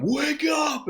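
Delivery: sudden high shout; mock yell, pitch leaping up; retold yell. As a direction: snap him awake with a high yell. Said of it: "He lunges at him".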